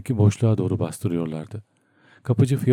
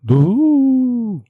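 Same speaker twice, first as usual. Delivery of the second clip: high, loud distant yell; a cry lost in the roar of water; Şarikov shouting from inside the flooded bathroom; muffled shout; 6 dB louder than usual. A narrator's reading. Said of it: "bir şeyler bağırdı Şarikov".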